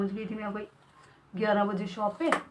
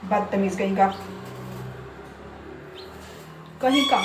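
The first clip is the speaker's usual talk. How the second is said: loud scolding; 3 semitones higher, 8 dB louder than usual. Real, not acted. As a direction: tell the parrot off loudly. Scolding pet parrot.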